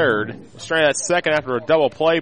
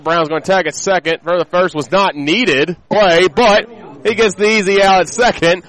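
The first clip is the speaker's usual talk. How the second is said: higher and louder, building excitement; excited play call; 6 semitones higher, 6 dB louder than usual. Real, not acted. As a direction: call the play with rising energy. Double play, live call.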